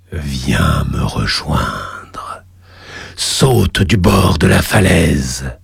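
deep, slow demon lord voice; low and slow, a voice from the depths; cavernous voice. Acted. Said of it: "une voix caverneuse".

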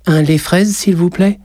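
put-on high, polite child voice; little boy. A narrator's reading. Boy orders from the café owner, high child voice.

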